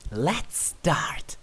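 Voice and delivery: whispered with relish; conspiratorial whisper; eager whisper. Acted. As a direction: breathe it close to their ear. Luna eager, leaning in close.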